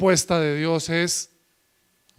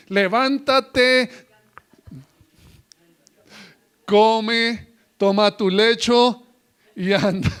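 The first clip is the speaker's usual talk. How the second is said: higher, louder, slow commanding call; proclaiming God's word; 4 semitones higher, 5 dB louder, slower than usual. Acted. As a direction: raise him from the bed with the word.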